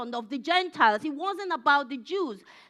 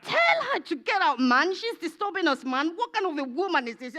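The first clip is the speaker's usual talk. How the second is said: mock-irritated demand, high then dropping on "man"; hammed-up pestered grumbling; annoyed disciples; 3 semitones higher than usual. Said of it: "Let me speak like".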